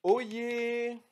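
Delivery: high, ringing herald's call; town crier. Acted.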